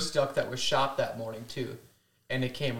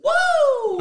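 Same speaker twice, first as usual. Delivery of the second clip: loud, high drawn-out whoop; celebratory whoop; 11 dB louder than usual. Retold or imitated. Hunter imitating the cheer after the shot, celebratory whoop. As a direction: let out a big imitated cheer.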